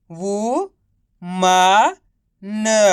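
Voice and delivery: drawn-out वू, मा, न, pausing after each; syllable by syllable. Shown as a demonstration. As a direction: sound out वू, मा, न separately. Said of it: "तो मिलके बन जाएगा". (वू, मा, न को अलग-अलग खींचकर)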